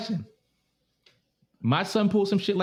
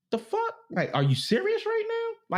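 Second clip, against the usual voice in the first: high pitched disbelief; mock outraged disbelief; incredulous. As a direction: squeak out your disbelief. Outraged reaction to scared son.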